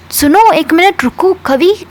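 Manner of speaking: high, slow call after a friend; a friend calling after Kavi as she walks away, high and slow. Retold or imitated. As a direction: call her back before she walks off. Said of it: "ऐसे बुलाती है".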